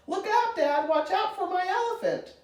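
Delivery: put-on high-pitched alarmed boy's call; urgent warning call. A narrator's reading. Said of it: "Timothy called".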